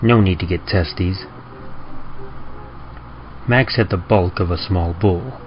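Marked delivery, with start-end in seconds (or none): none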